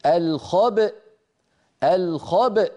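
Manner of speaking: الخبأ ending in a glottal stop after the ب, slow. Shown as a demonstration. Teacher demonstrating the pause on الخبأ. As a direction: close the throat on الخبأ. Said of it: "فنقول".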